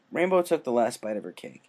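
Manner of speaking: ordinary delivery, nothing marked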